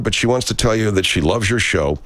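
usual speaking voice

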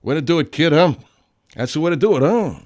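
loud enthusiastic cheer. Real, not acted. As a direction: cheer her on. Cheering louder.

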